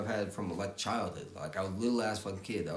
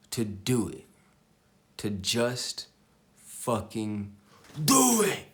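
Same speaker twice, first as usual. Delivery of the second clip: urging, building to a full shout on do it; rising push that explodes on the last do it; building to a yell; 2 semitones higher, 6 dB louder, much slower than usual. Real, not acted. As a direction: motivational rant building into a yell. Urging viewers to act.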